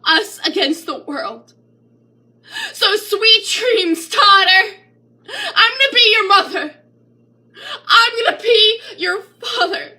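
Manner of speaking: high, loud, voice breaking with tears; a loud tearful pledge to her daughter; tearful vow. Acted. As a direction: cry it out as a promise.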